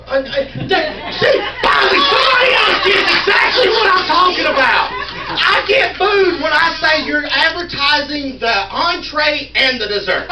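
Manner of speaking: loud, high shout of relief at being understood; high-pitched, emphatic outburst; excited vindication. Real, not acted. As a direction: shout it out, thrilled someone finally gets it. Finally a listener agrees with him.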